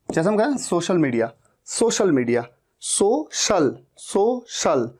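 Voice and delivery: ordinary talk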